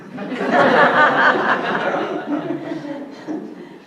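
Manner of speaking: a high, long laugh; loud long laugh. Real, not acted. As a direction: laugh hard at the story. Laughs after the Downton story.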